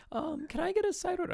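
high, small mock server voice; timid server. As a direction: squeak it out like a meek little server. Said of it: "a poor little server come up like".

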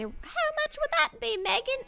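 mimicking friend. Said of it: "And then he asked me".

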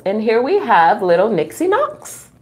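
plain reading voice

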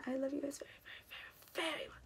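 tender whispered goodbye; whispered love. Real, not acted. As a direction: breathe it to the viewers. Sign-off love to viewers.

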